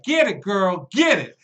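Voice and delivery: loud, high whooping encouragement; screaming cheer. Acted. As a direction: holler it like a wild fan from the back of the club. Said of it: "she is just screaming".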